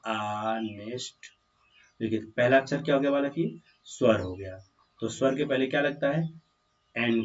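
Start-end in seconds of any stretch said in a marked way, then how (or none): 0.1-1.1 ऑनेस्ट drawn out very slowly, h left silent; pronunciation demo. Shown as a demonstration. Teacher showing pupils how honest is pronounced, slow pronunciation demo. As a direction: softly stretch ऑनेस्ट, opening on the vowel. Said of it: "इसका उच्चारण क्या होगा?".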